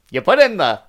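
mock old Hollywood transatlantic voice; transatlantic accent. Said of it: "in a transatlantic accent".

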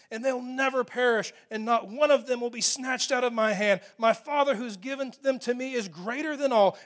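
loud, ringing proclamation of scripture; preaching at full force. Acted. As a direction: proclaim it to the whole church. Preacher proclaims Jesus' words.

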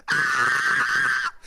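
low, long mock scream; hammed-up holler of fright; imitated scream. Comic retelling fireworks chasing his legs, mock scream. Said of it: "I was, like, screaming".

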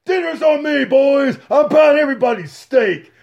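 high, hearty shout; mock generous champ. Mocking Fury offering steak to the boys, high hearty shout. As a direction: call out the treat proudly. Fury mocked treating everyone.

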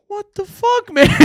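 high-pitched baffled sound engineer; baffled sound guy. Retold or imitated. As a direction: squeak out his disbelief. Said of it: "and he's like".

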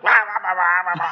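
loud nonsense "rah" ranting; imitated raging rant. Raging driver seen through closed window, mock rant. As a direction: imitate a road rager yelling gibberish. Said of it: "he was just road raging hard".

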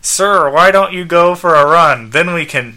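high, loud, earnest robot helper; insistent robot companion; Skeets. Acted. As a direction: report the diagnosis as a dutiful robot. Robot urges his owner to exercise.

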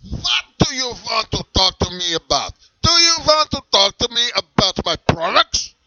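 high, loud stern German dominatrix-type voice; a strict German leather seller, pushy; thick put-on German accent, leaning into every word. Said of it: "a hard time with your German accent".

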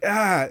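short high "ah" of pain; imitated pained cry.

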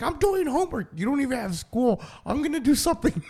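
put-on high, whiny-edged kid protest; imitated kid. Acting out Ballad objecting to chores, high indignant kid voice. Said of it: "He's like".

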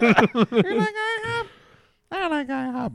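high, breathy granny; chirpy grandma wanting pancakes; old lady voice. Acted.

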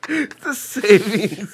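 slow high squeal of laughter; drawn out while cracking up; laughing. Real, not acted.

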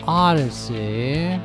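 ordinary talk, nothing marked

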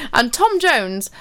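ordinary delivery

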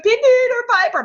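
high, loud, drawn-out wail; mock crying. Child actress playing the stuttering girl, mock crying. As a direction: imitate a sobbing child, hammed up. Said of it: "She's, like, crying and going".

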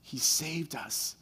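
a survivor whispering thanks in disbelief; hushed relief. Retold or imitated. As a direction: let the relief sink in quietly. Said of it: "One of them says".

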